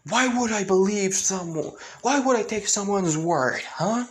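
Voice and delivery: high, pressing challenge; indignant. Acted. The girl confronts Izuku, high indignant challenge. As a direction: demand it, refusing to trust him.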